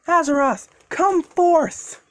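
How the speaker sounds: high summoning yell. Acted. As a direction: shout the name out. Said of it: "Deku was again yelling".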